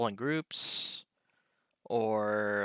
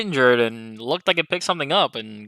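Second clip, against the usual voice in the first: loud, higher-pitched report shouted to companions; yelling it out; 4 semitones higher, 9 dB louder than usual. Acted. Player tells nearby party members about the injured eagle, yelling it out. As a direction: keep hollering the news to everyone around. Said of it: "I'll just yell it out".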